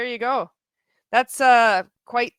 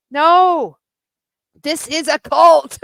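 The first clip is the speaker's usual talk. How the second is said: loud slow "this is a cult"; emphatic declaration; 5 semitones higher, 8 dB louder, slower than usual. Real, not acted. Answering the greeting-card idea, loud emphatic verdict. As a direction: slam it down as the punchline. Punchline retort to viewers.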